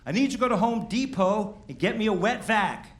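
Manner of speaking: high, loud panicked plea; imitating himself. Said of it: "I go".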